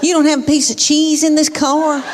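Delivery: mock dramatic grumbling child; complaining daughter. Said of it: "she says something like this".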